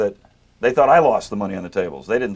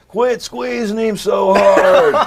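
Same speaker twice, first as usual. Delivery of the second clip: slow, loud, drawn-out yell from the crowd; heckler's scream; 8 semitones higher, 7 dB louder, much slower than usual. Retold or imitated. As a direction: bellow it slowly from the back row like a heckler. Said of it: "one of these Canadian guys screams out".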